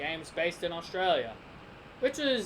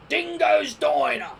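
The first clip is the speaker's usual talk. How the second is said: loud, drawn-out mock-Aussie shout; hammed-up Australian accent; 6 semitones higher, 7 dB louder, slower than usual. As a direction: belt it out in exaggerated Aussie. Voicing Australian character's diner name.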